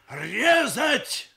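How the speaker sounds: high, very loud command; shouting. A narrator's reading. Surgeon uncle giving the exam answer, shouting. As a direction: yell the order out. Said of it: "закричал дядя".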